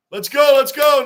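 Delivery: loud, high rallying shout; hype cheer. Real, not acted. Streamer rallying his chat during the game, loud cheer. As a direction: pump the crowd up.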